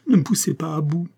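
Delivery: high, anguished warning; pained moan. A narrator's reading. Tormented servant warns her mistress, pained moan. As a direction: plead at the end of endurance. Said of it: "l'accent d'une douleur intolérable".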